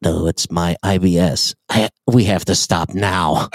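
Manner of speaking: very low, urgent confession; imitated backseat passenger. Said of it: "She goes".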